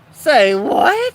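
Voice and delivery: high, loud disbelieving "say what"; can't believe what he just heard; incredulous. Retold or imitated. Reacting to friend's remark.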